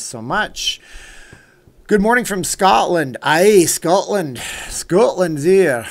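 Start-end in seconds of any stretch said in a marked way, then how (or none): 1.9-3.2 loud, high, bad Scottish accent; Shrek. Streamer greeting a viewer from Scotland, in bad Scottish accent. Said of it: "my worst Shrek impression yet". 3.2-5.9 loud, slow, hammed-up Scottish accent; Shrek. Streamer hailing a Scottish viewer, in loud mock Scottish accent. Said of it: "my worst Shrek impression yet".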